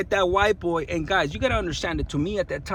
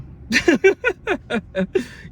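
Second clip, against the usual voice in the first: burst of laughter; 8 semitones higher than usual. Real, not acted. Laughs recalling the brother link.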